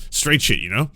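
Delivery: ordinary talk, nothing marked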